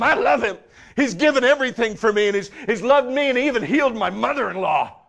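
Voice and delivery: from pleading to full-throated shout; anguished shouting confession. Acted. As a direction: cry it out in broken passion. Peter's anguished confession voiced.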